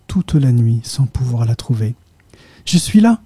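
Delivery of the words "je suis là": much higher happy call; girl calling to her grieving parents, higher and louder; cried out. A narrator's reading. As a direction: shout it as you burst in. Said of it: "cria Charlotte".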